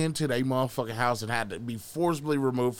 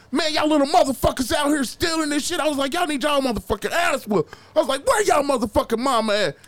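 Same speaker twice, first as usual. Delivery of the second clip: high, worked-up angry rant; going off; 11 semitones higher than usual. Retold or imitated. Big man confronting little pool thieves, going off. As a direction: go off on them, voice pitched way up. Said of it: "just fucking going off".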